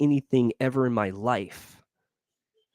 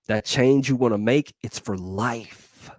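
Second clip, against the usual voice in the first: usual speaking voice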